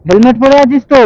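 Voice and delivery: উঁচু গলায় জোরে জিজ্ঞেস করা; চেঁচিয়ে খোঁজ নেওয়া; জোরে প্রশ্ন. Real, not acted. Checking a rider wears helmet. (Helmet পরেছে কিনা, জোরে জিজ্ঞেস)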